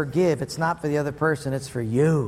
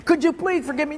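higher-pitched begging; acted pleading; 9 semitones higher, faster than usual. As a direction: beg like the guilty driver. Driver begs for forgiveness.

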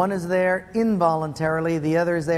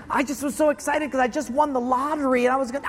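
higher-pitched, apologetic explaining; pleading excuse; 6 semitones higher, faster than usual. Acted. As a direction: plead your excuse as the guilty driver. Driver explains himself pleadingly.

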